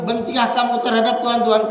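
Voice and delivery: ordinary talk, nothing marked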